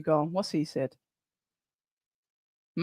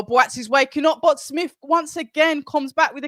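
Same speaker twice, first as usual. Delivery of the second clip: sudden ringside shout; excited shout; 6 semitones higher, 3 dB louder than usual. Real, not acted. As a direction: burst out as the fighter rallies. Fight swings, commentator erupts.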